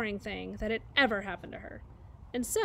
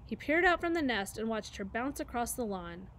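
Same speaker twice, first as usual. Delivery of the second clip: ordinary delivery, nothing marked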